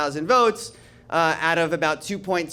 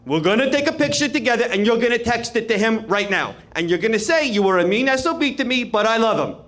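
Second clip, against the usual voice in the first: Cuomo voice; 3 semitones higher than usual. Retold or imitated. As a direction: boss him through the photo op like the governor. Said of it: "He goes".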